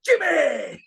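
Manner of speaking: high taunting yell thrown back; mocking shout back. Pitcher yelling the taunt back at the dugout. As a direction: throw their chant back at them. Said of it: "turned to their dugout and went".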